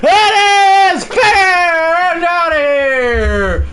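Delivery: screaming, high, stretched out announcer yell; home-run call. Acted. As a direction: roar it as the ball leaves the park.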